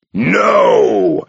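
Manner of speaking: sudden loud roar of denial. Acted. Abbot roaring at the monk in outrage. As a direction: bellow it out. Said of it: "Arcos roared".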